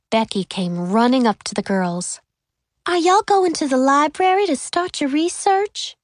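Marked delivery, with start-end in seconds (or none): 2.9-6.0 put-on gentle high Southern girl voice; soft Southern accent. A narrator's reading. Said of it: "in her soft Southern accent".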